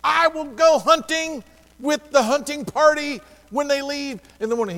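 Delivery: high, loud mock yell at the pastor; retold shout. Telling the pastor off, a high loud mock shout. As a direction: bark it back high and loud, half joking. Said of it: "I'm like".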